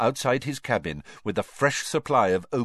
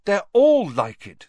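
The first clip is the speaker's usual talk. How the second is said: higher, louder, slow despair; desperate; 10 semitones higher, 9 dB louder, slower than usual. A narrator's reading. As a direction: plead it, voice lifting high. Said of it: "said Hermione desperately".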